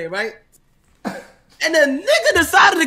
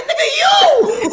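high, drawn-out shouted comeback; laughing, loud acted disbelief; exasperated retort; 5 dB louder, much slower than usual. Retold exasperated answer to "who?.

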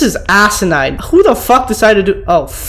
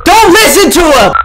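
raised, urgent plea; loud plea; 8 semitones higher, 4 dB louder than usual. Real, not acted. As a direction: yell at the ghosts. Pleading with ghosts not to listen.

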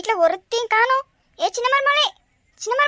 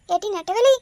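ordinary talk, nothing marked

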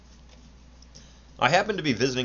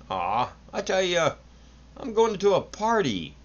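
sullen arguing; 9 semitones higher than usual. A narrator's reading. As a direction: sulk and argue like a stubborn boy. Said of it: "the son argued silently, or sullenly".

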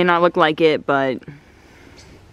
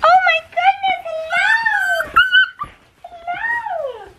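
after crying; slower than usual. Real, not acted. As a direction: coo at the puppies with a breaking voice. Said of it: "Sorry for this voice".

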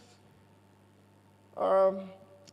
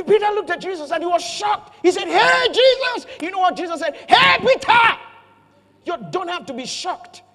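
much higher and louder, laughing through it; excited shouting; 11 semitones higher, 12 dB louder than usual. Real, not acted. Preacher excitedly acting out Jesus calling Peter, shouting.

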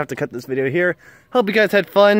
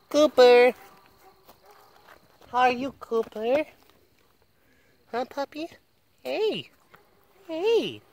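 puppy talk; 9 semitones higher, slower than usual. Real, not acted. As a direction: coo at the puppy in a high voice. Talking to the puppy.

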